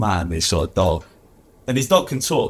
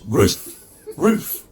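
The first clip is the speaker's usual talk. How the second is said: barking dog; 4 semitones higher than usual. Acted. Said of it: "he goes, "Rough, rough, rough".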